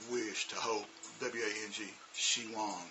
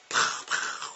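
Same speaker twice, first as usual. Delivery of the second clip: 1 popping pow; 7 dB louder than usual. Shown as a demonstration. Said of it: "I want them to have a strong ow sound".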